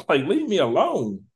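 high-pitched mock exasperation; retold fed-up plea, voice jumping up high with a laugh; exasperated. Gamer retelling a fight with the game's villain, exasperated. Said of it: "She was getting on my nerves".

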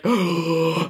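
a mock wheezing gasp for breath; fake asthma gasp. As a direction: gasp as if an asthma attack starts. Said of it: "pretend to have an asthma attack".

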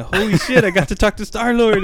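high-pitched retold gushing; starstruck excitement. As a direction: gush like a starstruck fan. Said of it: "that's how I felt".

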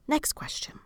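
soft voiceless whisper; whispered cue to herself; whispered aside. Real, not acted. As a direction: breathe it out as a quick aside. Aside moving to next question.

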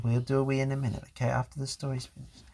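fast, low brush-off; hurried aside. Real, not acted. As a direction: rush it quietly to move on. Father quickly dismisses interruption.

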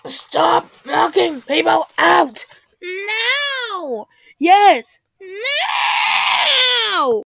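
high, loud, slow shouting. Acted. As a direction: scream back and forth. Acted quarrel between voices.

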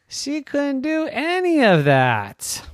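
high, fast mock wail; hammed-up cry; mock despair. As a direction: throw up your hands in mock despair. Repeated lament, high.

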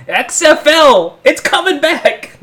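high, slow, drawn-out cry; mock outraged ranting. Bus ranter reading the news aloud, mock outraged cry. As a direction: announce it like an outraged crank.

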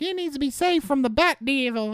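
pleading in a squeaky cartoon voice; mock anime girl pleading; high girly voice. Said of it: "And she's like".

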